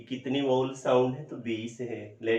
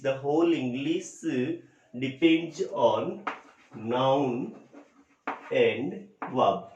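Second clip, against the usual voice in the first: 1 slow emphatic teaching; much slower than usual. Shown as a demonstration. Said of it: "teacher stressing key phrase slowly".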